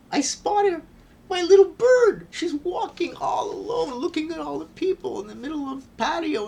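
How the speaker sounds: high, loud, thrilled outburst; excited retelling. Retold or imitated. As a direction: cry it out in overjoyed amazement. Climax of finding lost bird.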